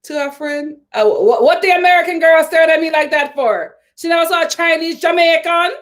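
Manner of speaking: high-pitched, badly faked Jamaican accent; terrible Jamaican accent. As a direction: imitate an indignant Jamaican woman with attitude. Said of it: "I did that terrible accent".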